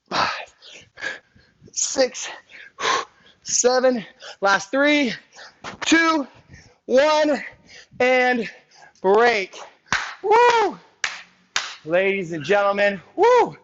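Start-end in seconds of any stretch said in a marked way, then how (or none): 0.1-9.6 excited countdown. Real, not acted. Coach counts down final seconds. 10.2-10.8 a very high, loud celebratory woo; high whoop. Real, not acted. Cheer after workout ends. 13.2-13.6 a very high, loud celebratory woo; high whoop. Real, not acted. Cheer to class.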